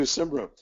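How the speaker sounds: Cymru said "SIM-roo"; common pronunciation. Shown as a demonstration. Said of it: "We'll do Simru".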